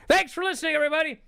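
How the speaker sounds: high, loud imitated announcer; Mike Tenay sign-off. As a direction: belt out a mock broadcast sign-off. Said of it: "Mike Tenay goes".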